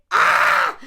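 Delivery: loud, drawn-out "ah!"; exasperated scream. Real, not acted. Host screams in exasperation mid-list.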